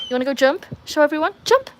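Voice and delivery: high, loud coaxing of the dog; excited dog coaxing. Real, not acted. Owner urging Ruby to jump for viewers.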